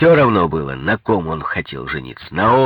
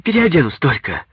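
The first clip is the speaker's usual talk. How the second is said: high, excited call from the doorway; 11 semitones higher, 3 dB louder than usual. A narrator's reading. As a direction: call back brightly, much higher. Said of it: "крикнула мне".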